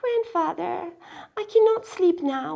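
put-on high child voice; crying out. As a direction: protest high like a girl. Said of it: "Miyuki cried".

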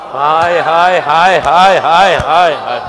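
low, drawn-out cries of grief; lamenting hai hai. Real, not acted. Preacher grieving before the congregation, lamenting cries. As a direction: mourn aloud, hai after hai.

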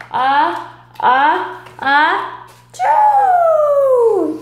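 building ah-ah-ah to a loud high choo; big storybook sneeze. Acted. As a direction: wind up then explode in a sneeze. Pepper on nose.

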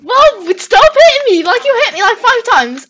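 high, loud protest; indignant complaint. Real, not acted. Player complains of being hit repeatedly, loud and indignant. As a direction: argue back at full volume.

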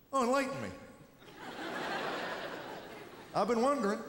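high mock-sincere sarcasm; sarcastic kid. Nine-year-old mocking the lecturing adult, sarcastic. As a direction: drip with fake curiosity. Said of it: "hear the nine-year-old go".

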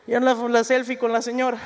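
usual speaking voice

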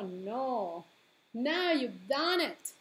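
usual speaking voice

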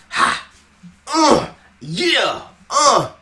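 loud, high hype shouts like a rapper warming up; mock rapper ad-libs. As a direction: bark out the rapper ad-libs, hammed-up. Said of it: "when a rapper be like".

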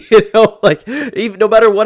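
can't keep a straight face; laughing. Real, not acted. Host laughs about the press clip.

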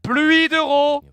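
slow, drawn-out game-show announcer; booming slow jackpot call. Pretend. Cheering cash from delivery.

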